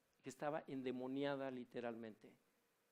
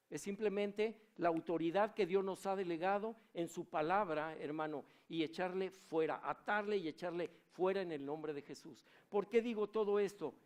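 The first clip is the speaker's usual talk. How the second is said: fiery preaching; 5 semitones higher, 7 dB louder than usual. Acted. Preaches at full force.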